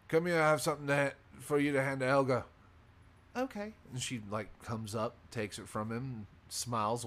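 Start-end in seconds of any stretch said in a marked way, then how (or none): 0.1-2.4 louder, higher, quick barkeep voice; halfling barkeep calling. Acted. Barkeep has something for barmaid to deliver, loud quick call. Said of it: "And he goes". 3.3-3.7 very high-pitched barmaid voice; a short, high reply; barmaid agreeing. Acted. Barmaid takes the errand from the barkeep, high voice.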